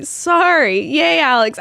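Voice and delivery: ordinary talk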